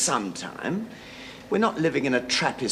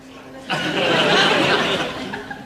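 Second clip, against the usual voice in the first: nervous laugh; 5 semitones higher, 4 dB louder than usual. Real, not acted. Coaxing the angry wife.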